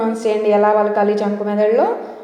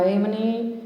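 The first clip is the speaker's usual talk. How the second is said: usual speaking voice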